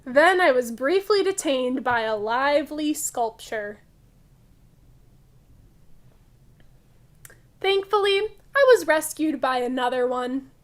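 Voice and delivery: loud, lively picture-book read-aloud; reading to children. Acted. Teacher reading a funny museum story to kids, loud lively read-aloud. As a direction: read it big and bright for the class.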